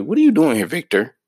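high, loud startled question; surprised sister. Retold or imitated. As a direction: play the sister caught off guard. Sister confronts brother in story.

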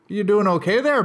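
friendly on top, hostile beneath; passive aggressive politeness. Retold or imitated. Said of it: "See that little inflection at the end?".

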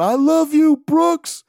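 high, loud mock sob-yell; imitating a sobbing yell. Crying fan yelling to Brooks, high loud sob-yell. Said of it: "I think he was trying to yell out".

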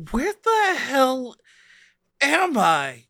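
high, drawn-out confusion; slow, high-pitched disoriented question; bewildered. Acted. Masked gunmen freed from the spell, bewildered.

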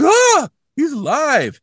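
high, slow, excited cry; mock Scully. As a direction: gasp it out high and drawn. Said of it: "And Scully's like".

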